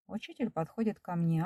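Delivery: plain reading voice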